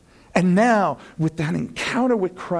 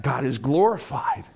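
ordinary delivery

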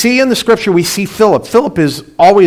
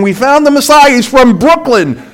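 higher, louder, amused mock announcement; mock excited quote; 8 semitones higher, 4 dB louder than usual. Preacher mocking a Messiah from Brooklyn, amused and loud. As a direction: sell the Brooklyn joke with a grin. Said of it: "That's like saying".

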